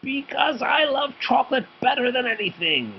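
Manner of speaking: a witchy teacher screaming her love of chocolate; crazed shout. A narrator's reading. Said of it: "shouted Missus Ferdman".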